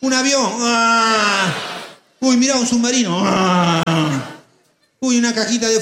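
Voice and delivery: shouted announcement of a passing plane. Acted. Storyteller calling out passing vehicle. (gritando un avión)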